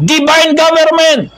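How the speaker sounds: high, sustained slogan call; rallying slogan cry. Shown as a demonstration. Preacher shows protesters what to shout, rallying slogan cry. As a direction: call it out as a chant for the crowd. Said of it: "Yung dapat isigaw".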